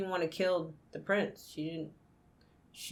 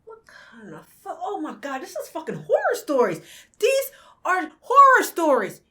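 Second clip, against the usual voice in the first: shocked outburst; 10 semitones higher, 7 dB louder than usual. Real, not acted.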